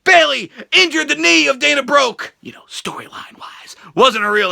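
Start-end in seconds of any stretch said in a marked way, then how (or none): 2.4-3.8 quick breathy whisper aside. Real, not acted. Whispered aside about fake injury.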